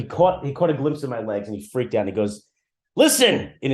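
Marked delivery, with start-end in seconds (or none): 3.0-3.5 put-on high, loud raspy old man's bark; raspy old rabbi voice. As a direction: rasp it out like a cranky old rabbi. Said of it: "in this, like, raspy voice".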